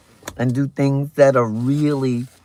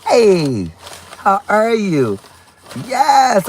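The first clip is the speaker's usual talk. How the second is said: usual speaking voice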